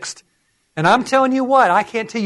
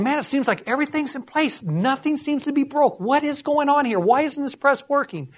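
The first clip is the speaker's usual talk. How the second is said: higher-pitched puzzled self-talk; retold bafflement; 8 semitones higher, 3 dB quieter than usual. Acting out his bafflement.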